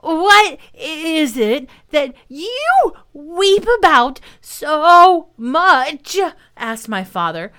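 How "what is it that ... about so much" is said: very slow, high and loud, out of breath; winded, drawn-out question; trying for breath. A narrator's reading. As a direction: force out each word between breaths. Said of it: "trying to get his breath".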